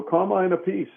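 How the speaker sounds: high-pitched mock stage introduction. Retelling the singer's call after the drum solo, high announcement. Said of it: "would go".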